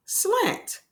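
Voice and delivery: "Slant" said high and clear. Shown as a demonstration. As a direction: announce "Slant" brightly. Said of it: "repeats word clearly".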